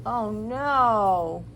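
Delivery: ordinary talk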